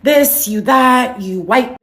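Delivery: mock yelled insults from commenters; mock accusations. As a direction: throw the accusations back, exaggerated. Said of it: "You guys can sit here and be like".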